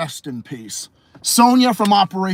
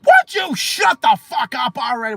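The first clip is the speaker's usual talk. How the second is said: high-pitched mock shout; exasperated retold yell; imitated screaming; 7 semitones higher, 3 dB louder than usual. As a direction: yell it like a fed-up repo agent. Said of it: "Sonya fucking screaming at people".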